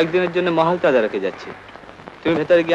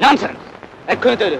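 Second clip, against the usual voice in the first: angry dismissal; 5 semitones higher than usual. Acted. Master cuts off servant's excuses. (রাগত ধমক)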